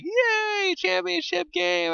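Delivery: high, slow squeaky cheer; mock excited. Gamer reliving his cheer before the championship, high and squeaky. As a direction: stretch it out like a giddy kid. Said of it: "I was like".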